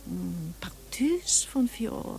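slow, breathy whisper. Acted. Widow sniffing the uncle's perfume, whispered slowly. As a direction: murmur it, savouring the scent.

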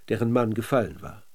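usual speaking voice

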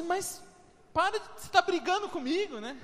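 high, mock-hurt wife's voice; lightly voiced offended wife; wife's complaint. Pastor imitating his wife complaining he's fighting with her. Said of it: "Aí ela fala assim".

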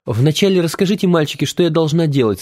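put-on light young woman's voice; girl's voice. A narrator's reading. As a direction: speak as the girl, lightly. Girl's voice, higher.

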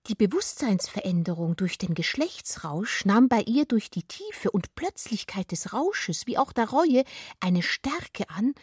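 ordinary delivery, nothing marked

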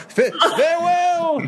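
high, shouted theatrical farewell; grand farewell. Acted. Traveller bids the family farewell as he leaves. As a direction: sweep out with a booming goodbye.

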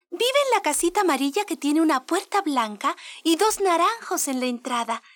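voz aguda de niña pequeña; niña inocente. Acted. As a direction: habla como una niña ingenua. La niña describe la casa. (voz de niña aguda)

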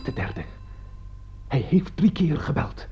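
breathless whisper counting the rings; whisper, afraid to break the silence; hushed disbelief at each ring; tense whisper. Acted.

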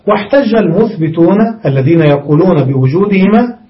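normal talking voice

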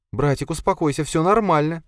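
put-on high-pitched girl's voice; anxious girl placating. A narrator's reading. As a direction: soothe an angry brother in a high voice. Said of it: "затараторила девушка".

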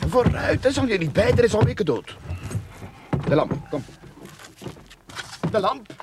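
high, loud impatient push; exasperated digger pushing his scared partner on; loud urging. Acted. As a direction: shove him forward with your voice.

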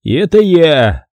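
slow, pleased-with-himself punchline; a big brother showing off to the guest, smug; smug boast. A narrator's reading. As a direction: lean on it like a show-off. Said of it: "ухмыльнулся Пит".

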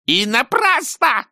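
high slow shriek; husband screeching at his wife. A narrator's reading. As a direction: screech it at her. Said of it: "завизжал он".

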